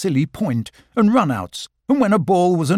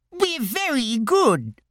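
put-on high, slow small-child voice; 10 semitones higher, slower than usual. Roo pleading to join the match, high and eager. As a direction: squeak it out like a tiny eager joey.

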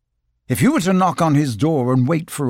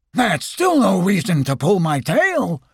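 high, bouncy, put-out voice; a cartoon tiger, offended; indignant Tigger; 6 semitones higher than usual.

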